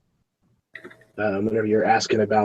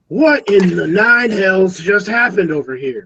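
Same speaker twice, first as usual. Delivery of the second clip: high, slow, alarmed town guard; 7 semitones higher, 4 dB louder, slower than usual. Acted. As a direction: bark it out like a startled guard.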